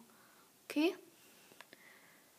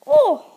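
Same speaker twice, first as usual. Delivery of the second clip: loud, high "oh" of pretend pain; pained yelp; 9 semitones higher, 14 dB louder than usual. Luigi under attack by angry birds, pained yelp. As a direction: yelp as if just hit.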